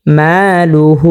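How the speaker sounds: very slow, drawn-out মালুহু; Quran recitation. Shown as a demonstration. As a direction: stretch the ma by one alif, chanting. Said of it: "যেমন".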